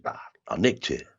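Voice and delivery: put-on cheeky South London accent. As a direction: confess it like a Bermondsey lad. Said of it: "my accent had completely slipped".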